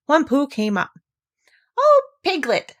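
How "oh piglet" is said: higher, louder excited cry; bursting with news for a friend. A narrator's reading. Pooh excitedly greets Piglet with big news. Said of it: "said Pooh excitedly".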